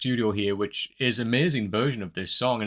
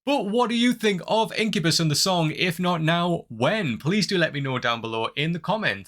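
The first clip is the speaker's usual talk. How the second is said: higher, lively sign-off to viewers; rally the viewers in a higher, bouncy presenter voice; YouTube outro pitch; 5 semitones higher than usual. Acted. Upbeat sign-off asking viewers to subscribe.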